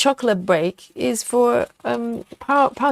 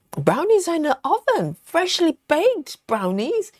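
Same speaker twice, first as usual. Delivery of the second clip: dreamy savoring; 7 semitones higher than usual. Acted.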